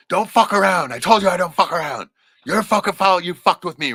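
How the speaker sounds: father yelling at his son Guy, enraged; father's furious yell. Retold or imitated. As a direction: lay into him for crossing the line. Father scolding Guy after fight.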